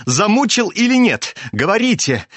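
high, urgent questioning; agitated demand, pitch jumping up; abrupt demand. A narrator's reading. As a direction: press him suddenly for an answer. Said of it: "Вдруг перескочил он к нему с вопросом".

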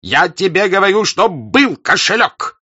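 loud, high furious yell; shouting. Acted. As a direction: shout it into his face. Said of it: "кричал Денисов".

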